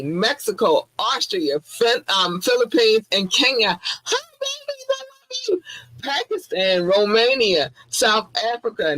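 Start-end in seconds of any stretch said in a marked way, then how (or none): 3.8-5.6 very high, soft whispered greeting; squeaky whisper. Real, not acted. Whispering hello to fans in a tiny high voice. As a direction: breathe it softly in a tiny high voice.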